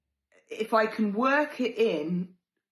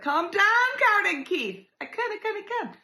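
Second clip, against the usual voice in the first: high, loud mock brush-off; mock exasperated; 4 dB louder than usual. Telling worried friends to calm down, high and mocking. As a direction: wave them off in a high squeaky voice.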